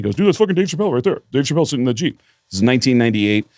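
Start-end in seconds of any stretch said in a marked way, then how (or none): 0.1-2.2 fast, higher, pointing it out; excited Bert. Retold or imitated. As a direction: rush it, thrilled to spot him.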